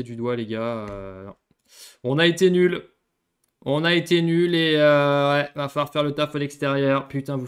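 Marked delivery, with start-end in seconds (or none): 2.0-5.3 scolding. Real, not acted. Scolding the team.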